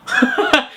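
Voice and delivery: high burst of real laughter. Real, not acted. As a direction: crack up at your own goofy voice. Laughing at own silly voice.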